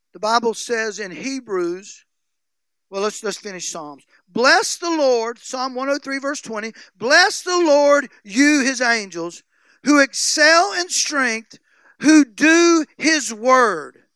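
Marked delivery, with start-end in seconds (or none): 4.3-5.4 drawn out, loud and raised; slow booming praise; slow preached proclamation. Acted. Preaching the psalm slowly. 6.9-14.0 drawn-out loud praise, each phrase hammered; booming slow scripture; slow preached proclamation. Acted. Preaching the psalm slowly.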